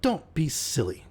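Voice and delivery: mildly tart brush-off; with asperity. A narrator's reading. Cynthia brushing off the proposal, mildly tart. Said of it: "with some asperity".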